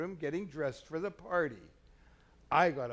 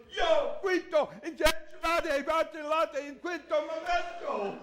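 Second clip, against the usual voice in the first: put-on excited Spanish-speaking young man; 12 semitones higher than usual. Husband imitates cook's son with knife, frantic Spanish. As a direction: shout it as an agitated young Spaniard. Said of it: "The strange young man goes".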